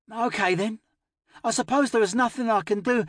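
put-on high, sulky child voice; resigned little girl.

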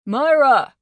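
loud high call across the room. A narrator's reading. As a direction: shout her name from the door. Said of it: "Boink called for Myra again".

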